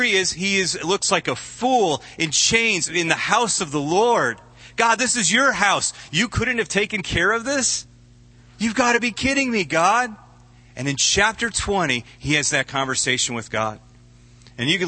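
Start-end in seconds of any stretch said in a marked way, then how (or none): 4.8-10.2 exasperated complaint. Acted. Preacher voices Jeremiah's complaint.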